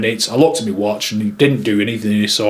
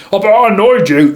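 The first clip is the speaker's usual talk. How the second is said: higher imitation, toned down a little; put-on character voice; 8 semitones higher, 4 dB louder than usual. Drunk guest owning up to the photographer, put-on voice. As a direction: do the impression in a moderately raised pitch. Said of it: "Sorry about the voices".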